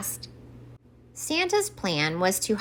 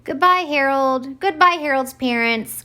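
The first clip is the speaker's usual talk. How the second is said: cheerful calling out; 6 semitones higher than usual. Acted. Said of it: "cried Santa".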